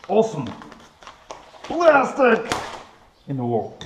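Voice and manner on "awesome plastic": excited. Real, not acted. Showing off awesome plastic part.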